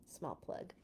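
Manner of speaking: unvoiced quick aside; whispered aside. Real, not acted. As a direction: slip the plug in under your breath. Aside mid-announcement.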